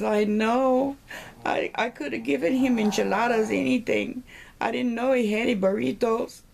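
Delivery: hammed-up funeral lament; mock lament. Second widow laments at funeral.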